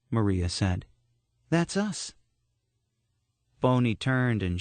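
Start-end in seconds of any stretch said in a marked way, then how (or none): 1.5-2.1 high, lightly voiced young woman; a touch of a girl's voice. A narrator's reading. Maria voiced.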